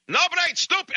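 put-on high-pitched yelling in a thick Russian accent; screaming Russian. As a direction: rant like a crazed Russian whose bumper got hit. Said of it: "he's screaming and yelling, the Russian".

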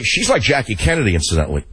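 ordinary talk, nothing marked

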